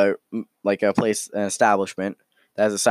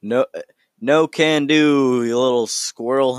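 vendor refusing; 3 semitones higher, 3 dB louder than usual. Acted. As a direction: stretch it out loudly. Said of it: "And the vendor is like".

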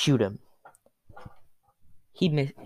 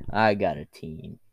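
cool and confident; 7 semitones lower than usual. Acted. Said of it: "And you're like".

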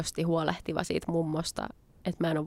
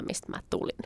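ordinary talk

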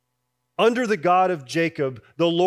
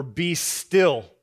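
"be still" said firmly, louder, a falling command; 3 dB louder than usual. Shown as a demonstration. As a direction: give "be still" as a firm order. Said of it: "you might read that as".

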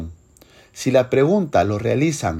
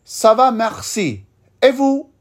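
slow, higher polite French answer; formal gentleman reply; 8 semitones higher, slower than usual. Acted. Second gentleman replying to the first, polite French. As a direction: answer courteously and unhurried. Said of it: "entre dos señores".

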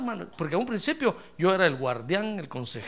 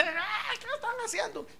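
mock yell; 12 semitones higher, 9 dB quieter than usual. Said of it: "Yo les grité".

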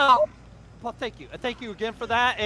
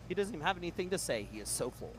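usual speaking voice

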